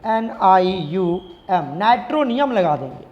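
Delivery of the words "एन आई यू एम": N, I, U, M letter by letter; slow, lower spelling for students; spelled out slowly. Shown as a demonstration. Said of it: "आगे क्या लगाएंगे?". (एक-एक अक्षर धीरे-धीरे)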